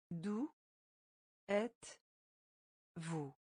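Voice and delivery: D'où alone, then êtes-vous drawn out slowly; slow teaching breakdown; slow word by word. Shown as a demonstration. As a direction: stretch out êtes-vous for learners. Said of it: "teacher splits phrase, stretches end".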